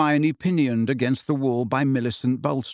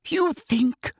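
menacing whisper; 14 semitones higher than usual. Acted. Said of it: "she whispered".

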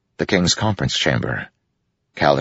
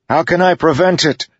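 much higher, louder urgent demand; 5 dB louder than usual. A narrator's reading. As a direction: insist on an answer over the wind. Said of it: "Kaladin demanded".

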